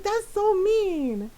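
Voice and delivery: high, drawn-out complaint; imitated kid protest. Brother complaining about sister's teasing, slow mock whine. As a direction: stretch it out like a wronged little brother.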